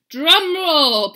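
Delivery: loud commanding call; ordering. Acted. Dog ordering the band, loud. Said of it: "ordered Don Diego".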